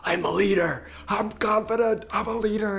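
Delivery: "I'm confident" and "I'm a leader" shouted high and loud; fired-up mantra. Shown as a demonstration. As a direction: belt "I'm a leader" with full force. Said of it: "I'm feeling it in my body".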